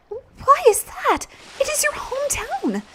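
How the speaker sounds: high, put-on heroine voice; concerned young heroine. Voices Elena questioning Ryudo.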